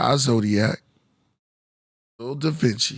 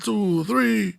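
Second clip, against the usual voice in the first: Count voice; 10 semitones higher than usual. Acted.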